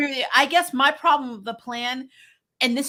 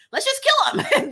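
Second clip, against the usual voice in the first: much higher, bright mock-eager let's kill him; eager Selina; 4 dB louder, slower than usual. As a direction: toss it off like she'd happily do it. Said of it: "Selina's a lot more like".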